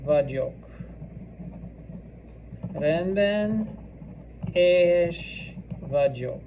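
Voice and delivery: drawn-out words with long gaps; slow dictation. Shown as a demonstration. Teacher dictating Hungarian for students to write, slowly. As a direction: space each word out for writing.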